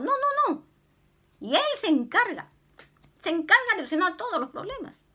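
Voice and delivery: high, loud, fast fiery preaching; fervent preaching. Acted. Preacher to the congregation, a loud, high fervent cry. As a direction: build it up to the congregation.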